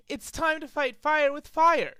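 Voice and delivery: determined declaration. Acted. Dash declaring a fight.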